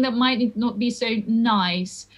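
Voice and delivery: normal talking voice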